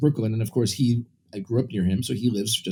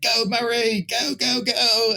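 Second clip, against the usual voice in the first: high, light mock cheer from the stands; imitating cheering crowd; 9 semitones higher, slower than usual. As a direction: echo the fans cheering him on, pitched up. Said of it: "everyone's like".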